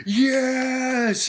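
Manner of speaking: high thrilled cry of triumph; excited yes. Retold or imitated. Fan recalling the theater moment, thrilled yes. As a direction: celebrate like the movie just won you over. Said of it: "I was super excited".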